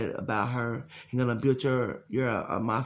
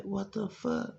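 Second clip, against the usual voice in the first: retold disbelief; 9 semitones higher, 6 dB quieter, slower than usual. Retold own stunned reaction.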